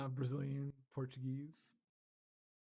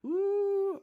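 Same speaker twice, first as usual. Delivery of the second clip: gotcha ooh; 10 dB louder than usual. Real, not acted. Catches the prank.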